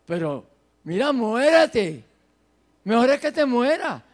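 loud, exasperated dismissal; scornful wife. Acted. Job's wife telling him to give up, scornful. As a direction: throw it out loudly, hammed-up wife. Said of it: "lo que le decía la esposa de Job".

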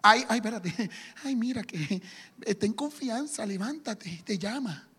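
hammed-up fawning crowd; mock hypocrisy. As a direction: suck up with a giggle. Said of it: "hay algo de hipocresía".

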